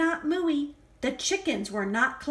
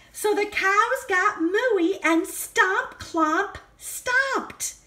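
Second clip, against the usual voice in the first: excited; 7 semitones higher than usual. A narrator's reading. Animals let loose.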